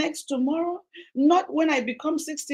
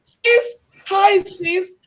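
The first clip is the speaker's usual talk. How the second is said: high, loud sisterly hello; squealed hi sis; excited greeting; 7 semitones higher, 7 dB louder, much slower than usual. Retold or imitated. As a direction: greet her like a long-lost sister. Greeting Doctor Julia as sister.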